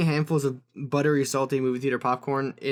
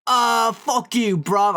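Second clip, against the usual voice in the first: loud, slow, higher British jeer; mock British accent; 8 semitones higher, 6 dB louder, much slower than usual. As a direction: drag it out like a smug British lad. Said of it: "And they're like".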